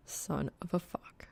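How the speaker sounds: unvoiced whisper, mortified; hushed mortified swear; whispered curse. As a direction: breathe the curse under your breath. Mortified curse under breath.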